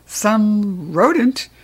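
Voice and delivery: rodent drawn out very slowly, with disdain; scornful rodent. Acted. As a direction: sneer the word rodent out slow. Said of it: "Nobody says rodent quite the way".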